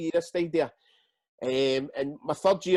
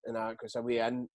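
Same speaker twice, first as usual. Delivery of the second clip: usual speaking voice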